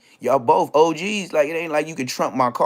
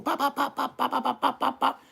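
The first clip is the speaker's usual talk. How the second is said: mock gunfire; 12 semitones higher, 5 dB quieter than usual. Imitating gunshots in story.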